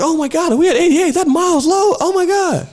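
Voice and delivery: put-on high, excited kid squeal; amazed kid. Blind kid realizing he's at the station, high and excited. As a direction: pitch up in awe like a child.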